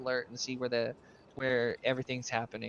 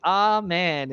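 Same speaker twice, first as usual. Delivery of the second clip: retold Amen said louder; a mock raised-voice Amen, like a prayer's close; voice turned up; 5 semitones higher, 8 dB louder than usual. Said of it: "He turns his voice up".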